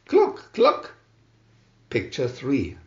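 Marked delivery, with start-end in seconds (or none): 0.1-0.9 high hen cluck. A narrator's reading. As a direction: cluck like a busy little hen. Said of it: "said the red hen".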